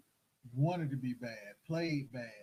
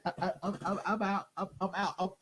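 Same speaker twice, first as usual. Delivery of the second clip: normal talking voice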